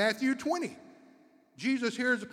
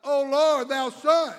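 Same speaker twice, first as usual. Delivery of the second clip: loud pleading shout; crying out louder; 6 semitones higher, 11 dB louder than usual. Retold or imitated. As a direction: cry out even harder for mercy. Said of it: "they cried the more".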